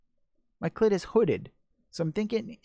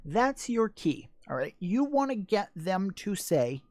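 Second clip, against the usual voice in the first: ordinary talk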